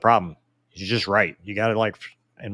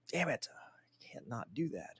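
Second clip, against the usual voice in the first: slow whispered aside to himself; 17 dB quieter, slower than usual. Real, not acted. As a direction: whisper it under the breath, slowly.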